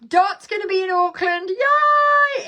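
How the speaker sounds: high, loud excited cheer; excited news. Real, not acted. Host tells viewers a friend visits, excited squeal.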